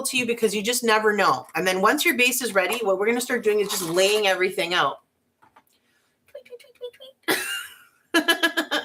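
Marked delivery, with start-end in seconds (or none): 6.3-8.8 put-on high, soft little tweets like a baby chick; chirping bird noise. Crafter playing her chick ornaments, high chirping tweets. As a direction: chirp it like a tiny bird, drawn out. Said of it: "I wish I could make a sound effect".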